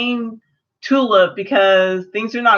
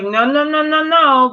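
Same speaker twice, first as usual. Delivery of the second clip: rapid string of objections, pitched higher; emphatic protest; 6 semitones higher than usual. Acted. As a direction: cut in with a rising, insistent protest. Acted objection to the villain's blame.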